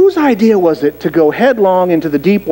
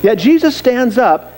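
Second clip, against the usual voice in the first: normal talking voice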